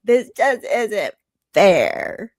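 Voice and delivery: slow, loud, drawn-out whine; whiny tantrum. Pretend. Host acting out a whiny tantrum about unfairness, whining loudly. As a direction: whine it like a sulking child. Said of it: "whiny temper tantrum moments where you're just like".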